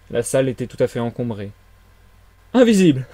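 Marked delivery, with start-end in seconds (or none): 2.5-3.1 high, loud cry with a laugh after; astonished exclamation. A narrator's reading. Villager hearing of an invisible man, incredulous. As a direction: exclaim it in disbelief. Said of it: "dit Huxter".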